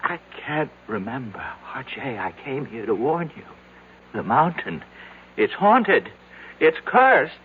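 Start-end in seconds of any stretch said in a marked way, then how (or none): 5.4-7.3 louder and higher, frightened insistence; a panicked plea; urgent warning. Acted. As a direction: warn him as if his life depends on it. Frantic warning to R J.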